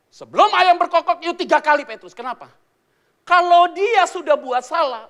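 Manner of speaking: loud, high, building preaching; fiery sermon voice; preaching at full force. Acted. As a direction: drive the story home from the pulpit.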